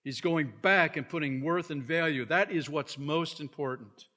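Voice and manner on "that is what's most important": normal talking voice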